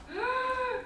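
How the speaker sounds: high soft gasp; amazed gasp. Acted. Child amazed by the whale story, a high soft gasp. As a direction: gasp in wonder.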